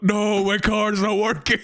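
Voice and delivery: high, slow whine; whiny panic. Pretend. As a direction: wail it like a stranded owner. Owner's car dies.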